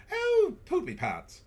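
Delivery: very high, loud mock British; slow, squealing hammed-up British voice; British accent. Host swapping in a sillier curse, loud high British accent. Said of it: "in your best British accent".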